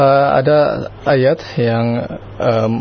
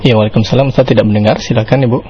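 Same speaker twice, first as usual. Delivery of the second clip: loud, raised voice to be heard; to someone hard of hearing; 8 dB louder, faster than usual. Real, not acted. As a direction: speak up clearly over a bad line. Caller's line too quiet to hear.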